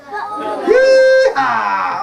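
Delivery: high, drawn-out cowboy whoop; whooping rider. Acted. Dog riding a goat, long excited whoop. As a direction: let out a long high yeehaw.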